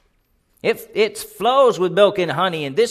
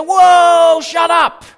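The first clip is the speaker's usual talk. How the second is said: loud, high shout for silence; shouting them down; 9 semitones higher, 8 dB louder, much slower than usual. Retold or imitated. Caleb shouting down the grumbling people. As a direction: bellow to still the crowd. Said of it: "He shouted them down".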